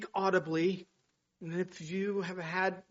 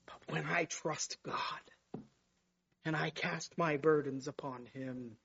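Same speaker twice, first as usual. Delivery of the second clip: hushed; 3 semitones lower, 6 dB quieter than usual. Real, not acted. Preacher turning tender about trusting God, whispered. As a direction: drop to a near whisper. Turns quiet after misery.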